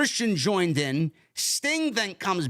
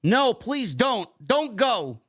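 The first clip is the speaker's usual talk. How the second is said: mildly pleading, pitched up and slow; begging; 6 semitones higher, much slower than usual. Retold or imitated. Recapping a mom pleading with her son, imitated begging. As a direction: plead slowly in a raised voice, retelling it. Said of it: "Nick's mom begged".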